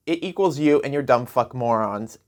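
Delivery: ordinary talk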